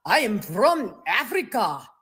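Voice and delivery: slow, put-on Afrikaans accent; mock Afrikaans accent. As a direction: imitate an Afrikaner insisting, drawn out. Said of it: "this whole charade about".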